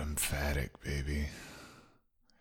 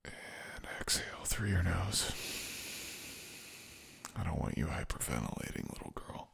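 whispering; 8 dB quieter than usual. Acted.

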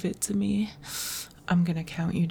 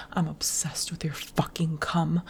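normal talking voice

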